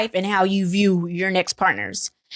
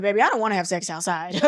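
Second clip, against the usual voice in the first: normal talking voice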